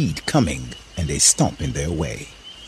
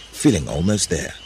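usual speaking voice

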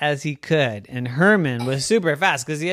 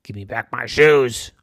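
mean farmer; 5 dB louder than usual. Acted. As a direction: hold it level and mean. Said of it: "a really mean look and said".

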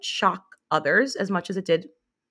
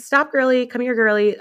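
higher, louder mock scolding; ironic girly-talk; 7 semitones higher, 4 dB louder than usual. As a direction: call it out in a playful girly voice. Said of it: "an obsession with saying "girly".

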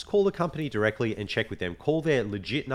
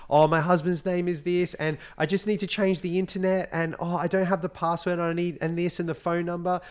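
high-pitched put-on flustered woman; manipulative caller; 8 semitones higher than usual. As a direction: play up the helpless, stressed wife. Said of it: "completely manipulating this guy".

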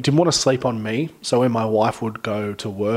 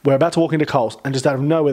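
ordinary delivery

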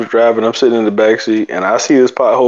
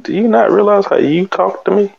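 put-on high-pitched indignant child talking back; indignant retort; 9 semitones higher than usual. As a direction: imitate a child's incredulous, high comeback. Acted inner retort to mom.

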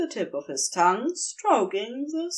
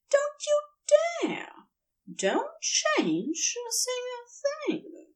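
sharp, high, urgent; anxious husband forbidding any change; urgent protest; slower than usual. A narrator's reading. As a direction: stop him in his tracks, then slow down. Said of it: "voice had lost its dreamy quality".

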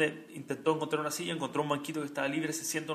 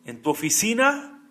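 emphatic preaching; 5 semitones higher, 10 dB louder, slower than usual. Acted. Sermón, clímax.

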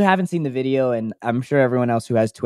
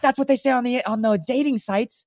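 high, aggrieved raspy rant; raspy bagel guy; 11 semitones higher than usual. Retold or imitated. Bagel guy ranting at women, high rasp. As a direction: rail at them in a strained high voice. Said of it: "And he's like".

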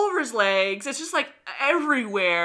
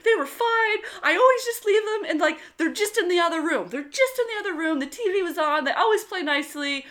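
higher, rushed pleading, retold; frantic self-defence; 6 semitones higher, faster than usual. Mom justifying herself to the nurse, frantic. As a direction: plead your case in a hurried high voice.

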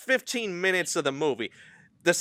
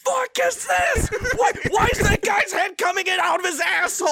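horrified screaming; 4 dB louder than usual. Retold or imitated. Friend reenacting buddy screaming at the gross scene, high wails. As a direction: scream in disbelief and horror. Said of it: "he just starts going".

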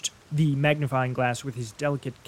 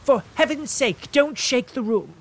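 higher, fretful plea; pleading invalid, higher; pleading cry; 11 semitones higher, 5 dB louder than usual. Acted. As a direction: beg him to stop. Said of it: "cried Mister Fairley".

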